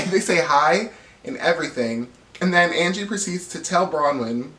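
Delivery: normal talking voice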